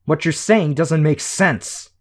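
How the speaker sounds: slow, loud, high furious snarl; loud angry snarl. A narrator's reading. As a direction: bite each word out in anger. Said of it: "Shadowstalker snarled".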